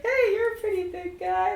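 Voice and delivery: high, bright ghostly greeting; friendly ghost. Acted. Friendly ghost greeting the big warrior, high. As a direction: chirp it like a cheerful spirit. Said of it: "One of the ghosts is like".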